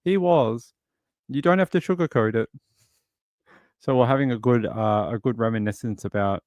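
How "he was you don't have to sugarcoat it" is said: high-pitched retold rebuttal; lightly acted contradiction, pitched up; mock insistence. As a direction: argue back playfully, voice lifted. Retold argument about grumpy granddad.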